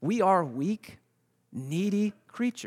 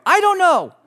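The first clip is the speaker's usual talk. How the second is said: very high, loud mock wail; hammed-up panicked cry; freaking out; 11 dB louder than usual. Wife answers where she is, freaking out. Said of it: "she's freaking out".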